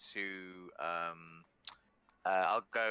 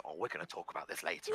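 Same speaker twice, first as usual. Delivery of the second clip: fast low warning; 7 semitones lower, 4 dB quieter, much faster than usual. Acted. Wife scolds Henry.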